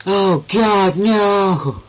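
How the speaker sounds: high panicked cry; panicked yelp. Real, not acted. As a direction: yelp as they close in. Swarmed by enemies in game.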